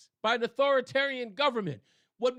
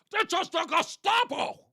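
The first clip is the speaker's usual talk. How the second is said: mock yell; 8 semitones higher, 3 dB louder than usual. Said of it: "I'd like to get back to yelling".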